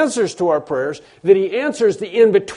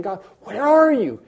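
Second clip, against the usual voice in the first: pleading to God; 5 semitones higher than usual. Acted. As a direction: call out, searching for God. Voicing believer's plea to God.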